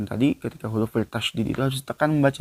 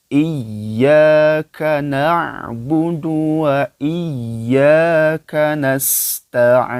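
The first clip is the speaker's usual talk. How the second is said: slow, louder melodic Quran recitation; 4 semitones higher, 8 dB louder, much slower than usual. Acted. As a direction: chant the verse slowly like tilawah. Recites verse together. (bacaan tilawah pelan dan lantang)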